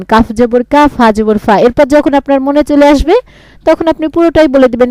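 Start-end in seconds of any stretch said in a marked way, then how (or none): 0.0-1.6 letter-by-letter drill; spelling aloud. Shown as a demonstration. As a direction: spell কাফ জবর কা then ফা জবর ফা. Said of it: "তখন আবার আপনি একটু বানান করবেন".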